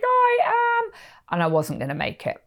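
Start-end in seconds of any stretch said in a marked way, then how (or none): 0.0-0.9 high, loud protest; mock-exasperated yell, high; strained reply. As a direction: shout back while straining. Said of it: "And I'm like".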